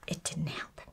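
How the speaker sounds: ordinary delivery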